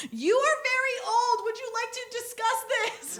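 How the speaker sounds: high, overly polite reasonable tone; high prim mock politeness; mock polite calm. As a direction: talk down to her sweetly, half laughing. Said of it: "I was like".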